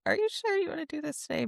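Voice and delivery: high, soft, careful check-in; tentative question. Retold or imitated. Host recalls gently checking with her guest, high tentative voice.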